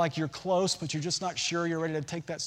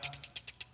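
soft breathy tick tick; whispered ticking; 15 dB quieter, faster than usual. Retold or imitated. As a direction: whisper the clicks of the climbing coaster. Imitating the coaster climbing.

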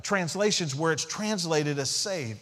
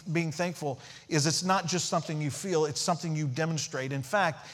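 ordinary talk, nothing marked

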